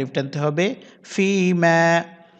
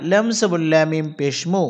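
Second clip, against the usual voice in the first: ordinary delivery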